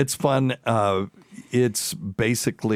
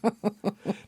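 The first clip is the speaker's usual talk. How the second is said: high laugh; 14 semitones higher, 3 dB quieter than usual. Real, not acted. Laughs at own joke.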